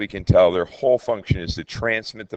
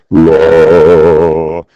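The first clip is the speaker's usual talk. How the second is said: loud, sustained low note; low pitch; 4 semitones lower, 15 dB louder than usual. Shown as a demonstration. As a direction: hold one long, low "la". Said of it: "is a low pitch".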